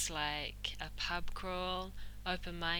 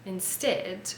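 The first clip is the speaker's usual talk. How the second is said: ordinary talk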